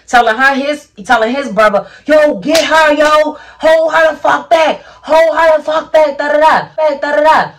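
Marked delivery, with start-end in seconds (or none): 2.1-7.5 high, loud urgent yell; imitated frantic shouting. Boyfriend telling his brother to grab her, imitated shouting. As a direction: holler for help holding someone back, pitched way up.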